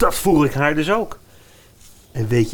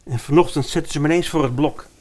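plain reading voice

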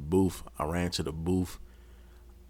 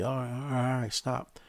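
mock surrender; 7 semitones higher than usual. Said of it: "to get him to say".

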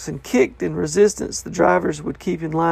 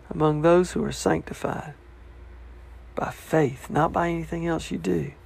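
soft, lowered preaching voice; hushed sincerity; 7 dB quieter than usual. Real, not acted. Preacher tells congregation salvation is by faith, hushed and sincere. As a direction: drop the volume and let the promise settle. Preacher softly brings home gospel promise.

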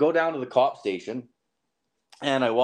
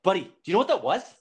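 excited realization; 7 semitones higher, 4 dB louder, faster than usual. Retold or imitated. Telling his buddy the realization, quick and high. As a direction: pitch jumps up, quick and urgent. Realizing it was a drug deal.

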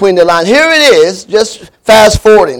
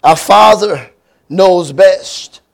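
slow, deliberate title announcement; sermon title; much slower than usual. Shown as a demonstration. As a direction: lay out each word of "A Father Knows Best" slowly, leaning on "Father". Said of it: "I wanna preach a sermon that I have entitled".

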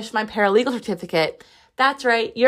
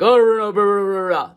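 mock garbled brush-off, a bit louder; imitating the flower shop guy's unclear babble; mumbling florist; 3 dB louder than usual. She mimics the flower shop guy's mumbled reply, mock garbled babble. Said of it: "That's really what he sounded like".

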